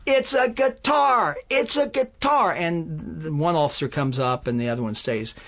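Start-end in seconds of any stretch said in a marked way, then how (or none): 0.0-2.5 high, slow frantic yell; yelling out the window. Retold or imitated. Songwriter shouting to armed officers, high slow frantic yell. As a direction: shout it so the cops hear. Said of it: "So I start yelling out the window".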